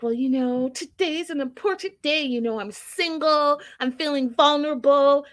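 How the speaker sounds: mock whiny Darcy. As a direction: play the wounded sister, a little hammed-up. Imitating Darcy's self-pity to Stacy.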